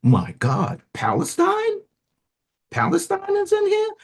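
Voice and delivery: real disbelief, pitch shooting way up; astonished. Real, not acted. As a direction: leap into a very high, incredulous question. Amazed to find Palestine in text.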